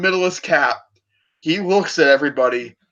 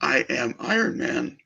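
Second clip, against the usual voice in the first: quiet and resolute; 3 semitones lower, 4 dB quieter, slower than usual. Retold or imitated. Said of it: "looks at him dead in the eye and goes".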